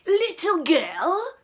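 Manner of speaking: loud, high, slow archduke call; grand archduke voice; pompous archduke. Acted. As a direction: boom it out like a haughty nobleman. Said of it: "said the Archduke".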